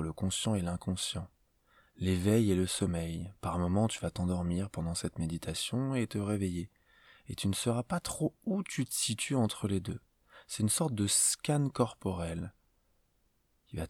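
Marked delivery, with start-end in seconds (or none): none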